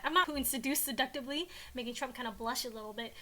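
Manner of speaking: ordinary delivery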